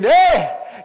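loud high "hey"; shout. Real, not acted. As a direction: fire up the congregation. Preacher shouts to congregation.

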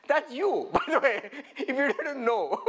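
laughing through the teasing line. Real, not acted. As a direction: tease them with a real laugh.

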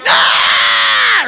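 loud imitated child's bawl; long hammed-up wail; mock crying wail. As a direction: burst out bawling like a little kid. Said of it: "He starts crying".

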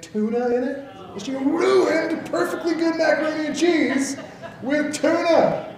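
high, laughing disgust at the memory. Retold or imitated. Pastor recalling mom's tuna macaroni, laughing disgust. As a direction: squeal it like the dish still grosses you out. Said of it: "It's disgusting".

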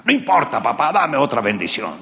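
high, loud insistent plea; begging Esaú. Acted. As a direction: demand another blessing. Esaú begs his father.

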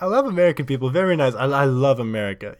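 loud, high, gushing mock Polish accent; excited Polish accent. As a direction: imitate an overjoyed Polish tourist's accent. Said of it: "I'm pretty sure it was Polish accent".

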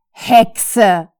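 loud, sneering insult; jeering taunt; mocking. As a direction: spit it out with scorn. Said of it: "spottete die männliche Stimme".

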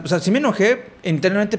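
normal talking voice

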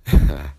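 a quick warm laugh; brief chuckle after the reading; short laugh. Real, not acted. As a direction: laugh once, amused.

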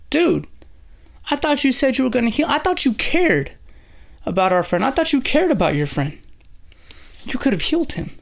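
high-pitched, pleading mock complaint; imitating a hurt, accusing friend; acted reproach. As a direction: confront a friend who let you down, voice way up. Upset friends confronting Jesus, acted out.

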